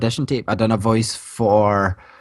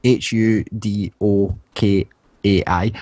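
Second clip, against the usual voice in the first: spelling slowly; 4 semitones lower, slower than usual. Shown as a demonstration. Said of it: "Dark Matter".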